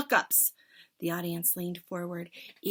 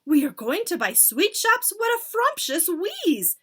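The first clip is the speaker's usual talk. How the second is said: very high, loud screeching chorus; witch chorus; 11 semitones higher, 8 dB louder than usual. Acted. Witches begging their leader, high screech. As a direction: shriek it like eager witches.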